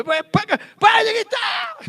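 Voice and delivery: high, loud shout, slowing on "gritar"; hammed-up teacher yelling to stop the noise; imitated shout. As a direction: yell at the noisy class. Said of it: "Ele tá gritando".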